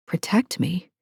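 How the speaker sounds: breathless whispered echo; whispered disbelief. A narrator's reading. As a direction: whisper it in confusion. Stunned murmured echo.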